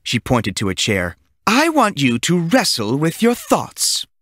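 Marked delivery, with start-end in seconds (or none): 1.5-4.1 slow, higher, otherworldly teacher voice; slow creepy teacher telling a boy to stay; eerie teacher. A narrator's reading. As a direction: drag each word out like a strange witchy teacher.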